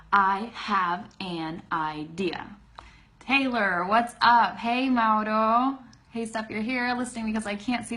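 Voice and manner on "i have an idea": very slow, each word fully pronounced; effortful word-by-word. Shown as a demonstration. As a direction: labour through every separate word slowly. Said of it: "it takes so much effort to say".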